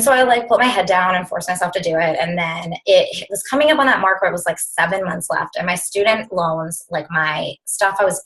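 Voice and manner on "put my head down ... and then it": usual speaking voice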